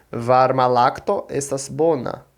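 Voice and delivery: "Varma lacto estas bona" in slow, careful Esperanto; pronunciation demo. Shown as a demonstration. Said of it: "Vou pronunciar".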